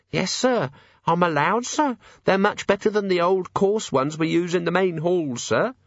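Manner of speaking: put-on high, timid servant girl; nervous young maid. A narrator's reading. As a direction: stammer it out, frightened. Maid voiced.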